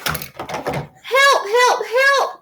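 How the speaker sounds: high little truck calling for help; crying for help. A narrator's reading. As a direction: cry out in a small high voice, hammed-up distress. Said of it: "cried the little blue truck".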